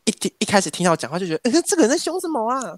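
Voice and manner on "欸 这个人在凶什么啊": puzzled listener. Retold or imitated. Listener reacting to her blunt talk.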